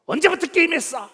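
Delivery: put-on high, loud scolding of the boys; scolding mother. Pastor voicing a mother scolding her sons at the café, loud and high. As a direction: snap at the kids like an angry mom.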